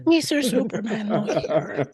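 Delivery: very high put-on cleaning-lady voice; high character impression. Attempting an old impression for laughing co-hosts, high character voice. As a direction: strain for the high voice, half laughing. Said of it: "I can't get my voice to go that high".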